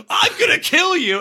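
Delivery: high shouted threat; mock murderous shout. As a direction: yell it like he's lunging at him. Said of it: "the guy was like".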